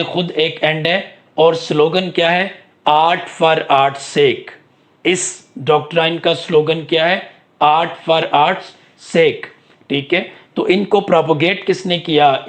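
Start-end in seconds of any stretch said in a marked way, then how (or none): 2.9-4.5 very slow, word by word, for note-taking; slow dictation. Shown as a demonstration. Teacher dictating the slogan to the class, very slow. 7.6-9.5 very slow and word by word, with a pause before the last word; slow dictation. Shown as a demonstration. Teacher repeating the slogan for students to note, very slow.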